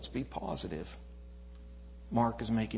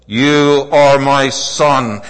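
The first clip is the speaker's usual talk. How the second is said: slow, loud, drawn-out proclamation; booming divine voice; 22 dB louder, much slower than usual. Acted. Preacher proclaiming God's voice over the crowd. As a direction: let God's voice ring out.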